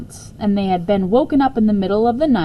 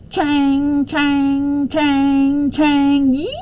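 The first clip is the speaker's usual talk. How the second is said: higher, louder metallic clangs; spluttering machine; 6 semitones higher, 3 dB louder, much slower than usual. Acted. The machine next door clanging and spluttering. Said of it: "spluttered the machine".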